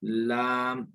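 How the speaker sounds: लाम with a long open aa, tongue edge on the gums; drawn-out letter name. Shown as a demonstration. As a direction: stretch लाम slowly, tongue against the upper gums. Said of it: "थोड़ा सा मसूड़ों के साथ मिलाते हुए".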